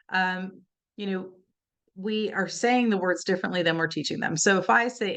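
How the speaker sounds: ordinary talk, nothing marked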